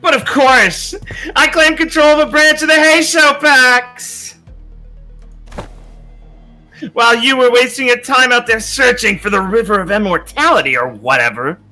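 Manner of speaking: loud, gloating boast; boastful shout. Acted. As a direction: crow it out like a braggart. Character boasts loudly.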